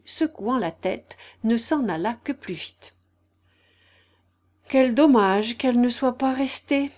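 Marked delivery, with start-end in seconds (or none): 4.7-6.9 higher, louder storybook regret; a touch of a wistful sigh; lightly acted lament. A narrator's reading. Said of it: "dit en soupirant".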